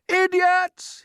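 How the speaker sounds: high, full-voiced insult; all-out high-pitched yell. A narrator's reading. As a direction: bellow across a distance. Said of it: "he yelled".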